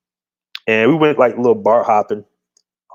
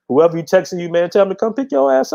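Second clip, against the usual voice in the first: retold cussing out; 8 semitones higher than usual. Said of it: "I said".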